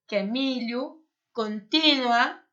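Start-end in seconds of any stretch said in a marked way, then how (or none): 1.3-2.4 contínua said slowly with the stress on the í, con-TÍ-nua; correct Spanish stress. Shown as a demonstration. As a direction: stretch out contínua and lean on the í. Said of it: "não é continua".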